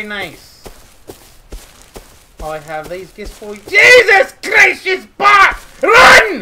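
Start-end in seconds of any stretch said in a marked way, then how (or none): 3.7-6.4 high, loud cry of alarm; panicked shout. Pretend. Warning a friend she's back, high panicked shout. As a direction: yell a frantic warning to flee. Alarm as naked attacker returns.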